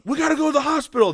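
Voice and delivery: high, fast comic panic; urgent hammed-up squeal; mock freak-out. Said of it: "they're freaking out".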